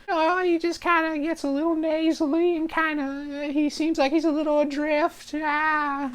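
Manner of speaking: very high, slightly nasally, drifting voice; Carl. Acted. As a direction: sound adrift, high and a little nasal. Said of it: "he sounds like that a little bit".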